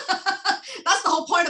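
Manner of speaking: laughing. Real, not acted.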